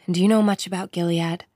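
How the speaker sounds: plain reading voice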